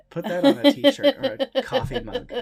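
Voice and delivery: laughing while joking. Real, not acted. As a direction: say it through laughter. Laughing joke.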